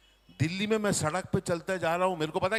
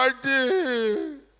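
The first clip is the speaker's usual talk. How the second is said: drawn-out call; 5 semitones higher, much slower than usual. Retold or imitated. Calling the Punjabi aunty.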